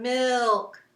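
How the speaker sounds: lingering, sustained 'milk'; long drawn-out word. Shown as a demonstration. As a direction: stretch 'milk' out. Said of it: "went on for a while too".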